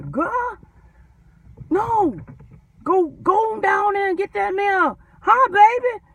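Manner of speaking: high, loud bossy sweet-talk, Atlanta style; Atlanta coworker impression. Mocking how coworkers in Atlanta talk, high and loud. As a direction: mimic a pushy coworker calling you baby. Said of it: "They talk like this here in Atlanta".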